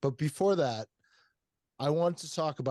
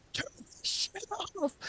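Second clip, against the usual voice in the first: much higher, softer put-on voice; mock disgusted snap; 6 dB quieter than usual. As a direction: wave the TV away in disgust. Seeing Bush on TV screen.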